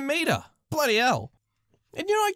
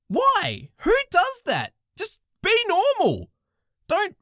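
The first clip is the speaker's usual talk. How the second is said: exasperated rant; 8 semitones higher, slower than usual. Real, not acted. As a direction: demand it, fed up. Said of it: "rant about the diaper".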